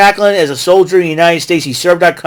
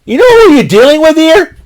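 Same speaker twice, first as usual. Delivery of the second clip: much higher, louder hyped-up cry; excited warning; 8 semitones higher, 3 dB louder than usual. Retold or imitated. Fan warning Maclin about PCO, hyped-up and loud. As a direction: shout it at him like a fan who can't believe it. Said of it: "I'm like".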